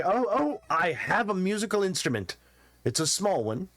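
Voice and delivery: high, eager trader voice; excited merchant. Acted. Merchant reveals a tiny harp, high and eager. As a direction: show off the harp like a proud peddler.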